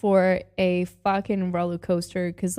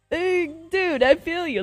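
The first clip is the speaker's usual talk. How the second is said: hyped cheer; 9 semitones higher, 3 dB louder than usual. Real, not acted. Cheering at dancing guy.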